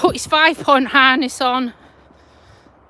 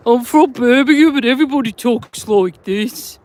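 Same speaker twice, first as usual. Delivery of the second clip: put-on Brummie accent, louder; Birmingham accent; 4 dB louder than usual. Shown as a demonstration. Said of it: "is the Birmingham accent".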